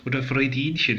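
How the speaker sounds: ordinary delivery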